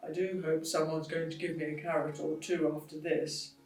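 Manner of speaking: soft muttered aside; quiet pony mutter. Acted. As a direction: grumble under her breath. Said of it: "then muttered".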